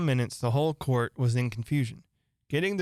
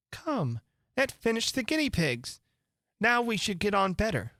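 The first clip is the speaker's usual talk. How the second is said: put-on chirpy girl voice; 8 semitones higher than usual. Character voice, high.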